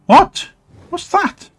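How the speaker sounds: sudden high startled yelp; surprised. Real, not acted. Gamer startled by a creature, high yelp. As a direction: jump at something strange on screen.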